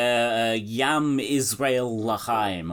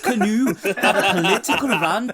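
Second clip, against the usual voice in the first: high, hammed-up Australian accent drifting Welsh; Australian accent slipping toward Welsh; bad Australian accent; 9 semitones higher than usual. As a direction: read the post in a failing Australian accent. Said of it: "Your Welsh accent is actually getting worse".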